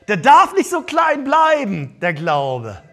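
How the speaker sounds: preacher's full-force shout. Shown as a demonstration. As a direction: shout the point to the back row. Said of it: "repeated louder".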